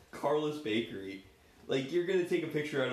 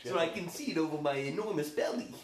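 hammed-up cartoon character voice, raised pitch; Joey Wheeler; 5 semitones higher than usual. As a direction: call out to a pal in character. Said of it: "I'm Joey Wheeler".

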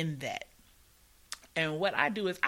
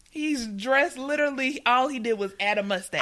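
excited; 9 semitones higher, 9 dB louder than usual. Real, not acted. Excited laughing rant.

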